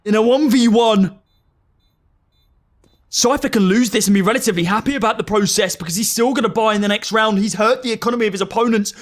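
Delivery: excited esports play-by-play; rising hype, call the clutch; esports caster. Real, not acted.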